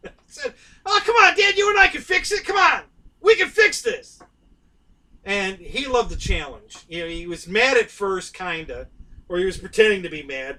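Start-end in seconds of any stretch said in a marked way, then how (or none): 0.9-4.2 put-on high, loud, wheedling little boy begging his dad; pleading kid. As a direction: coax dad like a kid who won't take no. Boy coaxing reluctant dad.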